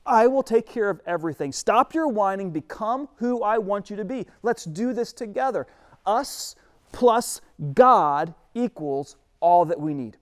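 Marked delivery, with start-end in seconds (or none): 0.0-5.7 God urging, a touch louder; God telling Moses to stop whining; firm God. Acted. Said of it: "God says". 6.1-9.7 slow, deliberate, each word weighted; preacher driving home the sermon's point; emphatic key line. Real, not acted. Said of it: "sermon key line".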